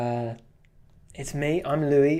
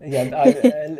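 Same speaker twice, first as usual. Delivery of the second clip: laughing; 7 semitones higher, 12 dB louder, much slower than usual. Real, not acted. Embarrassed at being exposed in chat.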